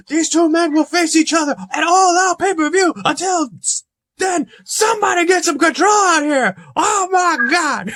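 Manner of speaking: very high-pitched, shouted wrestling commentary; hammed-up ringside hysteria, straining to the last word; frantic Jim Ross. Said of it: "says Jim Ross".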